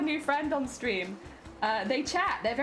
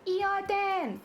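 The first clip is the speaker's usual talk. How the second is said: put-on high, slow anime-girl coo; wide-eyed cutesy high voice; high-pitched cutesy voice; 5 semitones higher than usual. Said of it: "I can speak high-pitched as well".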